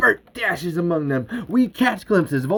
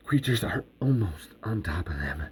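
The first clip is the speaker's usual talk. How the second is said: low, soft suspenseful narration; hushed suspense; 10 semitones lower, 9 dB quieter than usual. A narrator's reading. Narrator as creatures close in, hushed. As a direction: drop low and soft for the looming danger.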